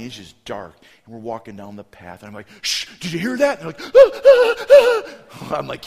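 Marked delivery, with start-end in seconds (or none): 2.6-3.6 mock alarm. Counselor spooks kids in dark. 3.9-5.6 loud high-pitched frightened gasps; scared gasps. Retold or imitated. Kids hear noise in the dark, loud panicked gasps.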